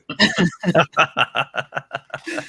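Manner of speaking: long run of laughter; real laughter. Real, not acted. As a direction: laugh at your own story. Laughing at her own anecdote.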